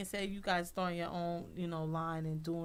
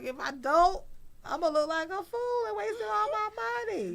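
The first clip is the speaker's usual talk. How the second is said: panicked; 13 semitones higher, 7 dB louder than usual. Retold or imitated. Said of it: "I was like".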